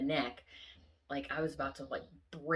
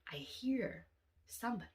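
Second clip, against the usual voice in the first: hushed, breathy whisper; 4 semitones higher, 5 dB quieter than usual. Retold or imitated. Retelling hearing an intruder, whispered. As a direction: whisper as if someone might hear.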